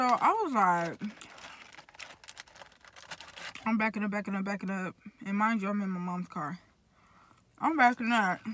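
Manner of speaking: speaking with food in mouth; talking while chewing. Real, not acted. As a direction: mouth partly full. Seen 3: puts food in mouth at 1.1, then jaw works while talking.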